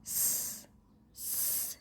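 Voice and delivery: स as a voiceless hiss, no vowel; snake-like hiss स; hissed s sound. Shown as a demonstration. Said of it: "आपको बोलना है".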